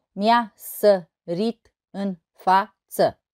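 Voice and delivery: slow, evenly separated syllables. Shown as a demonstration. Teacher showing six syllables in total, syllable by syllable. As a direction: count out each syllable of the verse. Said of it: "deci șase silabe în total".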